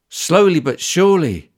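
usual speaking voice

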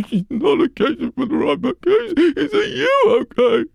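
put-on high, loud, tearful old crone; weepy old woman. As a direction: cry it out like a grieving old woman.

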